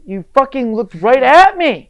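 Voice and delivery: outraged at being ignored, yelling; retold angry shout. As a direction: shout it at her, furious and slow. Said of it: "And I'm like".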